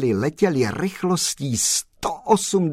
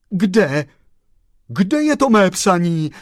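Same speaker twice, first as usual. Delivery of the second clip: higher and louder, urgent asking; eager call; 4 semitones higher, 4 dB louder than usual. Acted. Chauffeur Frantík calling out to the postman, urgently. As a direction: call out desperate for the letter. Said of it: "zvolal pan šofér Frantík".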